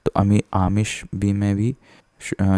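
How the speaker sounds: ordinary talk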